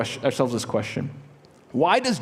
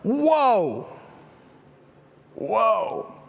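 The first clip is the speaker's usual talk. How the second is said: awestruck whoa; 7 semitones higher than usual. Retold or imitated. Jacob at the holy place, stunned, breathes a high whoa. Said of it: "this is him going like".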